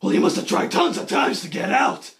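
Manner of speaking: low, raspy put-on growl; hammed-up whispery growl; gravelly Batman voice. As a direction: rasp it out like movie Batman. Batman dialogue.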